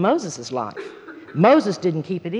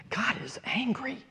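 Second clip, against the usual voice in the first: near-whisper, very soft; hushed realization; 4 semitones higher, 11 dB quieter than usual. Retold or imitated. Woman suddenly realizes God's anger, hushed realization. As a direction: whisper it like a dawning, awed thought.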